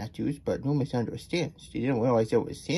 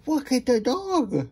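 high, drawn-out excitement; delighted exclamation; 12 semitones higher than usual. Real, not acted. Reader pointing out the sand dog, high excited call.

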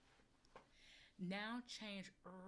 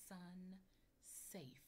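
soft echo; 8 dB quieter than usual. Shown as a demonstration. Teacher softly echoing so the child leads. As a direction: murmur along with the child. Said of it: "You say it".

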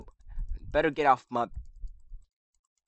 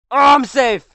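high, loud relieved cry; relieved shout; 11 semitones higher, 13 dB louder than usual. Real, not acted. Escaped death in game.

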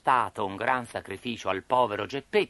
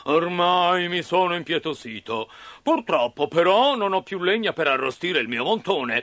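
gruff puppeteer; 7 semitones higher, 4 dB louder than usual. Acted. Puppeteer sparing Pinocchio but needing firewood, menacing. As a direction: declare it as a fearsome boss. Mangiafuoco figure.

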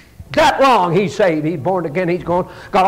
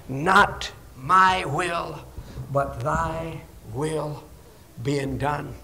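low, soft, very slow, word by word; quiet surrender; 6 semitones lower, 8 dB quieter, much slower than usual. Acted. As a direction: yield gently, pausing between phrases. Models a surrendered attitude of prayer.

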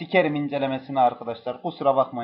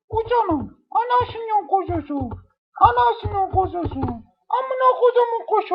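put-on falsetto baby talk; 4 dB louder than usual. Gamer sweet-talks the bird in a high coo. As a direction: coo at the bird like a doting mother.

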